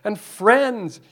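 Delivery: voicing Smeagol. Smeagol pleading with the demon to go, high and whiny. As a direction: whine it like a hopeful Smeagol. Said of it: "then Smeagol says".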